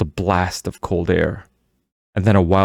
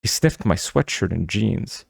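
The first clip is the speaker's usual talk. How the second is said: usual speaking voice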